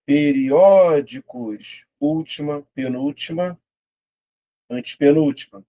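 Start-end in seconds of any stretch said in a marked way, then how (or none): 0.1-1.9 "Periódicos" slowed, Ó stretched long; syllable by syllable. Shown as a demonstration. Teacher testing an option for stress, syllable by syllable. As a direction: draw out "Periódicos", swelling on Ó. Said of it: "Vou separar".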